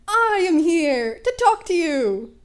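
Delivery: loud, slow booming hero voice; heroic All Might. Acted. All Might booms at the two boys on the roof. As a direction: announce it like a grand hero.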